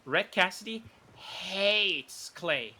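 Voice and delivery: slow, with hates drawn out and hit hard; stress on hates. Real, not acted. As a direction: lean on hates, slowly. Stressing a key point.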